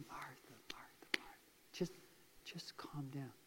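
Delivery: whispered, soothing calm down; tender whispered reassurance; gentle whisper. Acted. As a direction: hush her worry in a whisper. Jesus soothing agitated Martha.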